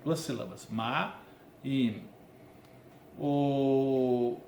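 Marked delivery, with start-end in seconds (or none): none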